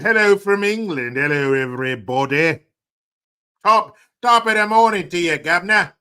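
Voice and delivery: loud, high hammed-up English accent; mock English accent. Streamer greeting Tracy from England, hammed-up English accent. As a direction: holler it like a cartoon Englishman. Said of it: "Which kind of accent do you have".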